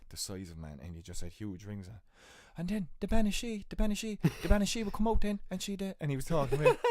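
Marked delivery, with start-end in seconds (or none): 2.6-6.8 put-on much higher, louder Limerick kid voice; Limerick kid's accent. Host imitating a Limerick kid telling of the Banshee. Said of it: "they were Limerick kids".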